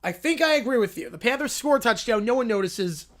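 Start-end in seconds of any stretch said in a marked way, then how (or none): none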